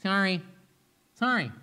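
loud, impatient sorry in short bursts; grudging apology. Acted. Husband placating his mad wife, grudging and insincere. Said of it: "is it just a good way to".

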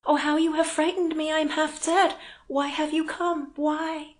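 high, loud distressed woman; overwhelmed, frightened. A narrator's reading. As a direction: plead in overwhelmed alarm. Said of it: "breathing hard, still pale and overwhelmed".

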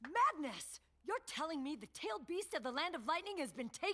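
high, indignant disbelief; hearing a tailed beast was taken, aghast; shocked outburst. Acted. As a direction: exclaim in outraged shock. Elder shocked by news.

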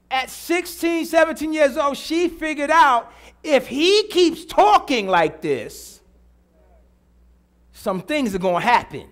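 slow, drawn-out preacher emphasis; emphatic preaching. Real, not acted. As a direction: hammer the point home to the congregation. Preaches the point once more.

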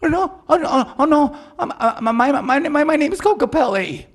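high, loud frightened stammer; panicked stammer. Acted. Terrified character stammers.

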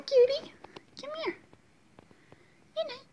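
very high, sing-song cooing. Real, not acted. Coaxing the cat to come over in squeaky baby talk.

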